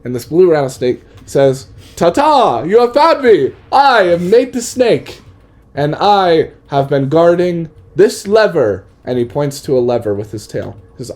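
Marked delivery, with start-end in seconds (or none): 1.9-8.8 high, loud theatrical proclamation; loud, high-pitched snake making a grand entrance; grand snake introduction. Acted. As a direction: announce yourself with a showman's fanfare, drawing out the name. Snake makes his grand introduction.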